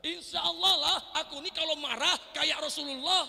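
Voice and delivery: high, loud, hammed-up boast; mocking a boastful listener. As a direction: mimic a smug churchgoer bragging. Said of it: "ada di antara Anda berkata". (menirukan jamaah yang sombong, tinggi dan keras)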